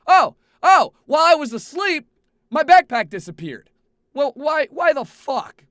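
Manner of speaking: high, loud panicked yelling; panicked outburst. Retold or imitated. Traveler panicking at empty airport seat, loud alarmed outburst. Retelling discovering backpack gone.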